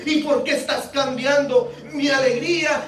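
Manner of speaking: high-pitched furious protest; acted outraged questioning; angry oak. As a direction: rail at your maker, demanding why. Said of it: "se enojaba y le decía".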